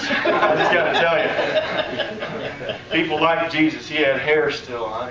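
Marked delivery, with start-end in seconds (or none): 0.0-2.8 laughing. Real, not acted. Laughing at his own joke.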